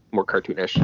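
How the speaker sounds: ordinary talk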